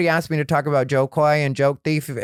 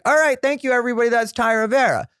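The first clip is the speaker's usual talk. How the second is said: stage sign-off; 8 semitones higher, faster than usual. Retold or imitated. Closing his set on stage.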